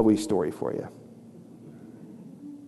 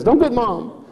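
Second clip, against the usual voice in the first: put-on high pleading child voice; hammed-up small kid's plea; Chloe; 10 semitones higher than usual. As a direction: imitate a worried little girl begging Dad. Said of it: "she says".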